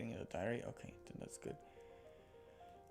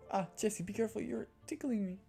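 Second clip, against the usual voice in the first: higher, livelier character voice; acted ticklish protest; 7 semitones higher than usual. Girl to Jessie during muscle rub, high ticklish protest.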